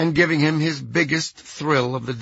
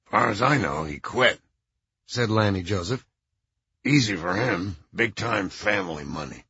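put-on low, stuffed-up old man; lower, congested, with a blocked nose; 5 semitones lower than usual. Lanny's voice continues.

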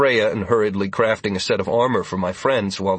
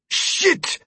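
panicked shout. A narrator's reading. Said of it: "Leandra shouted in a sudden panic".

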